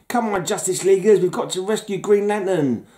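rally the team, a little hammed-up; mock heroic rallying. Hero rallying Justice Leaguers to rescue Green Lantern, mock urgent. Acts out comic cover dialogue.

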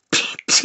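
sharp voiceless "pew"; zapping noise. Acted. Comic dismisses a profile with a zap noise. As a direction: fire off a mouth-made laser shot.

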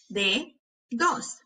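"Dedos" slowly as de, pause, dos; broken into syllables. Shown as a demonstration. Teacher helping hear each sound, syllable by syllable. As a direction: break "Dedos" in two. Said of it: "quiero que las quiebres entre sílabas".

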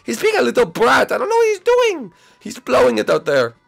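high, loud squealed complaint; exasperated outburst. Acted. Complaint about the boy's behaviour, high and exasperated. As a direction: let it burst out high and loud. Dad and son argue over date.

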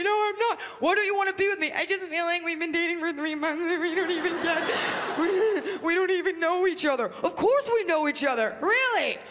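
high melodramatic back and forth; melodramatic quarrel. Retold or imitated. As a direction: play both lovers over the top. Acted breakup quarrel.

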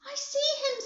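very high, excited reply; thrilled high exclamation; excitedly. A narrator's reading. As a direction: answer with a leap in pitch. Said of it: "said Grandpa Joe excitedly".

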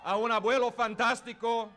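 shouted ring announcer build-up; lucha libre announcer. Real, not acted. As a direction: hype the crowd like a ring announcer. Bilingual rally introduction, shouting.

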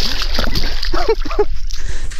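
high gasping laugh from the cold; breathless shocked laugh. Real, not acted. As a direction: laugh through the freezing shock.

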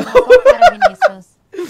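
loud, high shout through laughter; laughing yell. Real, not acted. Streamer yelling at Vinícius in laughter, loud laughing yell. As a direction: holler it while laughing.

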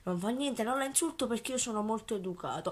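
soft, falsely polite and proper; mock polite. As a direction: quietly play the well-mannered gentleman. Mock polite calm address.

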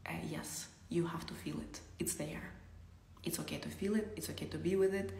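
hushed, soothing whisper; tender encouraging whisper; soft whisper. Acted. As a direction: whisper it gently to someone in pain. Whispered guidance through pain.

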